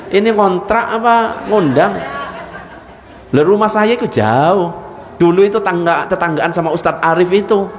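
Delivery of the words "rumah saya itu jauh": normal talking voice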